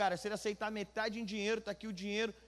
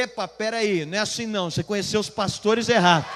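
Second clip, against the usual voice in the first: emphatic comeback; 15 dB louder than usual. Retold or imitated. Pastor turning the trucker's words back on him, loud firm comeback. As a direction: throw the protest straight back, forcefully.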